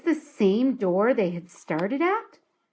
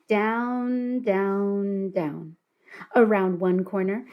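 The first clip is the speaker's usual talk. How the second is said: ordinary delivery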